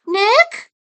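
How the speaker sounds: put-on high young female rabbit voice, calling Nick's name with a rise; calling out as the sister. Sister at the grave calls out to Nick, high and questioning. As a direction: call his name from a distance, unsure. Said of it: "a familiar voice called".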